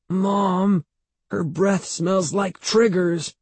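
put-on high child's whine; miserable exclamation. A narrator's reading. Boy complaining to his mother about his aunt, miserable. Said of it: "Evan exclaimed miserably".

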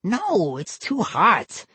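put-on boy answering dully; slow kid reply. A narrator's reading. Vinny answers.

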